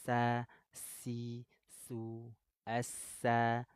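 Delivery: sa si su with a sharp hissing s; sin drill. Shown as a demonstration. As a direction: drill the s syllables. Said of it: "suara tambahan desis".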